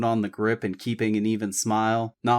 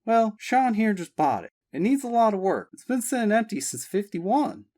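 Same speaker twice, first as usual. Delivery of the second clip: Abby voiced; 11 semitones higher than usual. A narrator's reading. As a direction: explain eagerly to Daddy. Abby to father.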